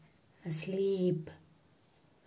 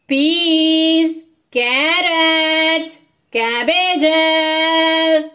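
loud, high, long-held street cry; vegetable seller's cry; 6 semitones higher, 8 dB louder, much slower than usual. A narrator's reading. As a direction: sing out each word like a street hawker. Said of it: "calls the vegetable man".

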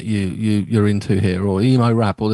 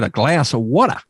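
American accent. Shown as a demonstration. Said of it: "You should have said".

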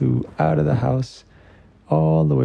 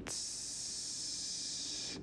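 hissing fuse sound; 23 dB quieter than usual. Acted. Fuse lit on dynamite.

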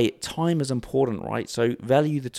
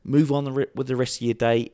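usual speaking voice

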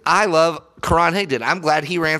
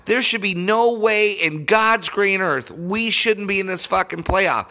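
ordinary talk, nothing marked

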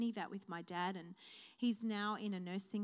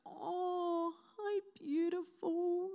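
tender, slow greeting pitched higher; imitating her dad; 8 semitones higher, much slower than usual. Daughter imitating her father's gentle greeting, tender. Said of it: "because that's how my dad speaks to me".

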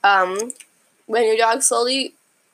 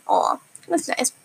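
tender aww; 3 semitones higher than usual. Real, not acted. Reacting to the husband tweet.

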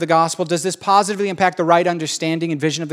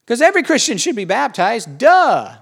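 mock Paul; 6 semitones higher than usual. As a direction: play Paul stating the obvious, then a snappy "Duh". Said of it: "says Paul".

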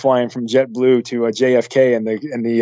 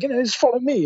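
put-on a light, high British accent; lightly put-on British accent, leading the way; 10 semitones higher, 3 dB quieter than usual. Girlfriend guiding brother through Quebec, lightly British accent. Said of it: "that's a British accent".

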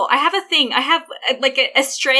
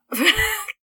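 laughing; 10 semitones higher than usual. Real, not acted. Laughs at own joke.

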